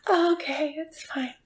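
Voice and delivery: fading to a breathless whisper; mock resigned whisper. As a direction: give in, trailing into a whisper.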